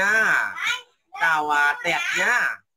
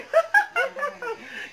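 high laugh. Real, not acted. Grandma laughing on livestream.

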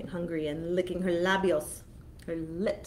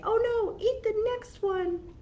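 little goat voice; 13 semitones higher than usual. Acted. Goat voice quoted.

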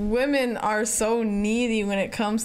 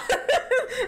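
hysterical laugh; 14 semitones higher than usual. Real, not acted. Laughing at movie scene.

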